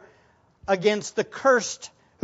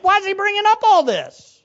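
very high mock protest; exasperated bafflement; 9 semitones higher, 3 dB louder, faster than usual. Preacher voices a listener objecting to talk of rank, exasperated. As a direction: protest in high-pitched confusion.

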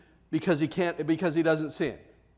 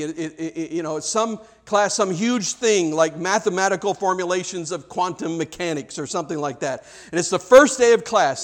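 usual speaking voice

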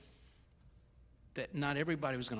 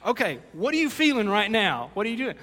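incredulous; 4 semitones higher, 11 dB louder than usual. Retold or imitated. Grandpa reacting to crying kid.

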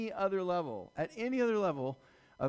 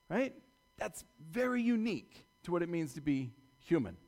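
usual speaking voice

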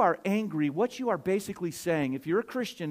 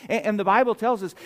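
raised, louder preacher's voice; higher, emphatic pulpit delivery; forceful preaching; 4 semitones higher, 5 dB louder than usual. Real, not acted. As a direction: drive the point home to the congregation.

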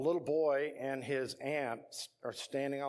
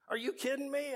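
amazed disbelief; 13 semitones higher than usual. Retold or imitated. Kid amazed at new bike.

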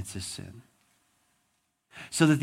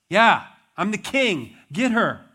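arrogant king; 7 semitones higher, 8 dB louder than usual. Retold or imitated. King brushes off the servant.

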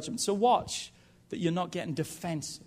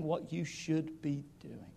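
hushed whisper; 9 dB quieter, slower than usual. Acted. Preacher dropping to whisper.

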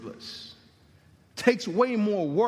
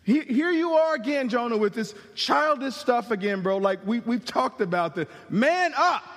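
higher, louder, exasperated bro scolding; impatient buddy; 4 semitones higher, 4 dB louder than usual. Pretend. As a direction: brush off a complaining friend. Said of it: "God didn't say".

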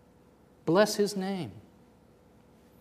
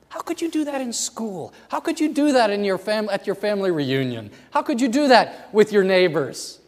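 high, louder challenging cry; rising passion; 7 semitones higher, 6 dB louder than usual. Acted. Preacher challenging the congregation, loud and high. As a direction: press the question hard, higher each time.

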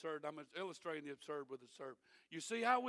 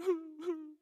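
a short, high mock sob, imitating someone weeping; a quick, half-hearted sob sound, higher and louder; imitated sob; 10 semitones higher, 7 dB louder than usual. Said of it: "And then another one".

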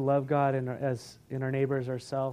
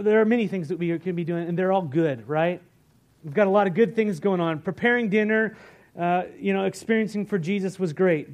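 loud, fired-up sermon delivery; preaching; 5 semitones higher, 9 dB louder than usual. Acted. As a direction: preach it out to the congregation. Sermon preaching at full force.

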